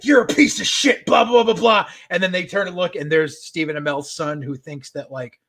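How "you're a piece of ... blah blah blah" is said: louder, higher mock tirade; telling the wrestler off in a half-acted rant; retold angry outburst. Actor telling off his wrestler, mock angry rant. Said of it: "and he's like".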